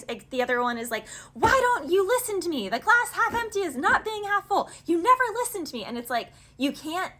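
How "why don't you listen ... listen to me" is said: high, loud, fed-up yelling at a partner; exasperated shout. Acted. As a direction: hurl it at someone who never listens. Acted quarrel over glass half empty.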